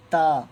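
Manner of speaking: تا said light and thin, tongue tip out past the teeth. Shown as a demonstration. Teacher shows students a thin تا. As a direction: read تا thin and fine, tongue between teeth. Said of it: "باریک کر کے پڑھنا ہے".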